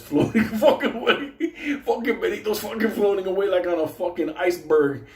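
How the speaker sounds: high, laughing storytelling. Real, not acted. Storyteller cracking up at friend floating away, laughing. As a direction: tell it through real laughter.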